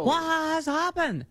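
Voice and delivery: high, slow, melodramatic gasp of dismay; mock horror at losing the house; hammed-up horror. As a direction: play the shocked homeowner, way over the top. Mock victim's horrified cry.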